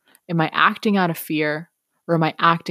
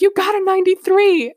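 much higher, louder delighted teacher; proud teacher; 6 dB louder than usual. Retold or imitated. Teacher telling the student her score, high and delighted. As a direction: announce it like a gift. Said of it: "She's like".

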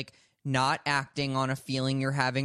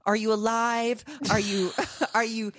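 higher, a lightly put-on chipper check-in voice; mock cheery Zach; 7 semitones higher than usual. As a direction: play the overly cheerful friend. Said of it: "he's just gonna be like".

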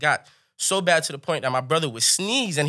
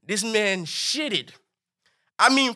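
ordinary talk

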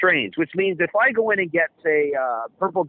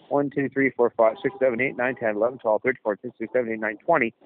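quiet quick counting; 6 semitones lower, 4 dB quieter, faster than usual. Shown as a demonstration. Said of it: "Okay, hang on a second".

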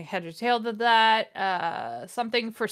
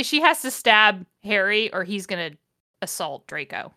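ordinary talk

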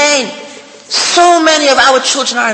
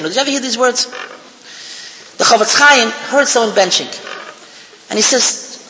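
usual speaking voice

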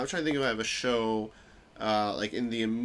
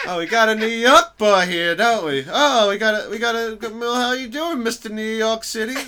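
high, loud mocking New York swagger; mock New York greeting; 8 semitones higher, 9 dB louder than usual. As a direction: tease him like a swaggering New York guy. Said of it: "trying to go vague and I went specific".

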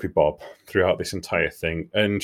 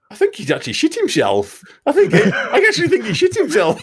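laughing disbelief; 7 dB louder than usual. Retold or imitated. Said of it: "And I says to him".

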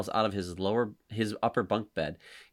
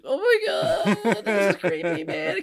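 squeaky high cry breaking into laughter; spooked viewer laughing, much higher; laughing fright; 9 semitones higher, 5 dB louder than usual. Retold or imitated. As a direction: laugh through a spooked shriek. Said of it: "I'm like".

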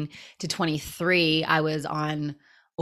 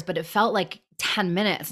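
ordinary delivery

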